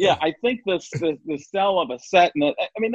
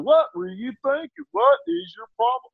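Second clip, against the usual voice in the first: much higher, scolding Doctor Phil; Doctor Phil impression; 12 semitones higher than usual. Doing Doctor Phil, scolding a guest on his show. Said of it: "Doctor Phil with that on".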